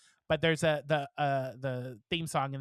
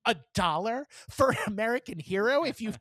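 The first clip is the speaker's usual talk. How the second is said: indignant outburst; 7 semitones higher than usual. Retold or imitated. To the passengers who gave one dollar, an indignant, rising outburst. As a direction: scold the crowd for giving a dollar. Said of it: "wanted to stand up and be like".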